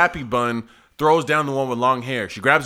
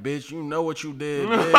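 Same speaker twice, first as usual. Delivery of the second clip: softer, understated mock insult; tired, calm arguing; 6 dB quieter than usual. Worn-out girl still arguing after fight, tired and calm. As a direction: imitate arguing with no energy left. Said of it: "It's like a calm conversation".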